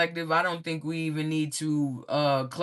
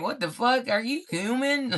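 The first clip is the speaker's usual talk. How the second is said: higher-pitched incredulous reaction; mock disbelief; 7 semitones higher than usual. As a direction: play their baffled astonishment, pitched up. Acting out their disbelief.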